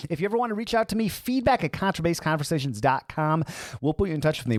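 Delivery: rattled-off podcast sign-off; hurried, rapid-fire outro patter; talking fast. Real, not acted. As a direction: race through the contact details. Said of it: "Why am I talking so fast".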